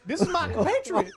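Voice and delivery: high, loud introduction; Bill the Turtle. Acted. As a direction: announce a companion in a goofy high voice. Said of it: "Bill goes".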